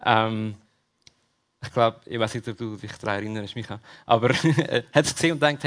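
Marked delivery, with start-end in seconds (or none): none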